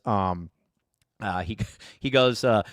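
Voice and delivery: ordinary talk, nothing marked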